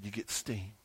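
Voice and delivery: low, quiet voice from above; voice of God. Retold or imitated. God answering the boy, quiet and low. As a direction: answer calmly as God, deep and hushed. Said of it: "He said".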